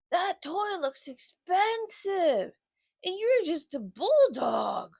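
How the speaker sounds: slow dopey dog voice, wowed; a lightly voiced lazy bulldog gushing over a toy; lazy bulldog Otis, amazed. Otis marvels at Charlie's new toy, slow and dopey.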